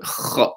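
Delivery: "Kha" unvoiced, a harsh scrape like a sore throat; scraped kha. Shown as a demonstration. Retelling Salma auntie's tip, showing kha as a scrape. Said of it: "you scrape the throat".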